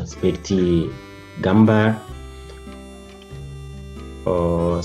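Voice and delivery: ordinary talk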